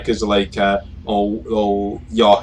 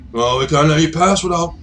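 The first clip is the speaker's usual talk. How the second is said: put-on bandit chief demanding toll; gang boss; 3 semitones higher than usual. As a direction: start high, settle into a threat. Gang leader threatens.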